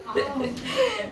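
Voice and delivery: high falling laugh. Real, not acted. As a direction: let out a warm laugh. Laughing at own story.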